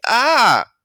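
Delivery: a small demonstrative "Ah!", not a real scream; boy insisting it was only an "Ah"; mild mock yelp. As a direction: play it down, just a little cry. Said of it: "I was just like".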